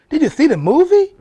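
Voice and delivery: loud, high challenge; shouted question. Acted. Challenging viewers about the movie.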